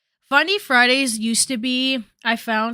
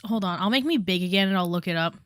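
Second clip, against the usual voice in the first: usual speaking voice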